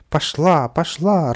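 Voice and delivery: high, loud gleeful shout; радостный крик. A narrator's reading. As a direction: cheer it on, thrilled. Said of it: "радостно закричал Каприска". (радостно кричит)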